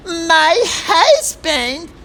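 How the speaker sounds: very high, drawn-out parody; mocking Meghan catchphrase. Host mimics Meghan's pet phrase, slow high mockery. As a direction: stretch it out high and hammed-up. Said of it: "Faster than you can say".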